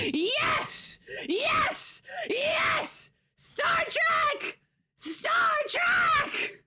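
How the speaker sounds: very high celebratory shrieks; triumphant screaming. Real, not acted. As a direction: scream it like you just won. Celebrates winning in the game.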